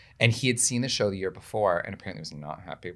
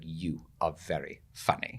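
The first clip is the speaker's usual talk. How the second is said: slow, low, softer British-hero delivery; Eric Idle; 5 semitones lower, 10 dB quieter, slower than usual. Retold or imitated. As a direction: give the compliment slowly and gravely. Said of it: "and he was like".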